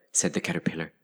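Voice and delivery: ordinary delivery, nothing marked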